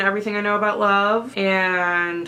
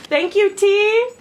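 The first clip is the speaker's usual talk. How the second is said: excited; 13 semitones higher, 7 dB louder, slower than usual. Real, not acted. Thanking seller aloud.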